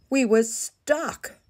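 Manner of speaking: pitched well up and slowed, aghast; dramatic dismay. A narrator's reading. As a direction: make the stuck moment big and alarmed. Narrator dramatises getting stuck.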